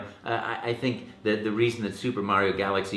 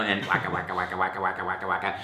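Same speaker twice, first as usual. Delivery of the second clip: Pac-Man waka; 2 semitones lower, faster than usual. Retold or imitated. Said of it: "my limited knowledge".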